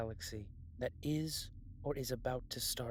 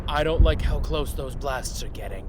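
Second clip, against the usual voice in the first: loud strained shout over explosions; shouting over blasts; 2 semitones higher, 17 dB louder than usual. Acted. Crew member shouting over nearby blasts, loud and tense. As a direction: yell it over the noise of the blasts.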